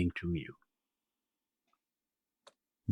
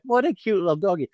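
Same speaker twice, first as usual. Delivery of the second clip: put-on much higher, cooing baby talk at a puppy; cooing at a cute dog; 9 semitones higher, faster than usual. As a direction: coo it like you're doting on a little dog. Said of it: "you're laughing like".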